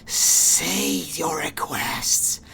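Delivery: slow, whispery mechanical hiss. Acted. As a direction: hiss each word slowly like a machine priest. Keeps the tech priest voice.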